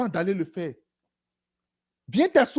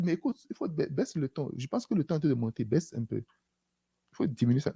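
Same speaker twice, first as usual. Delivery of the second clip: softer, gently lowering the voice; soft, calming voice of God asking to lower the tone; calm hushing; 4 semitones lower, 8 dB quieter than usual. Acted. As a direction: talk someone down, quietly and soothingly. The Lord calming a raised voice.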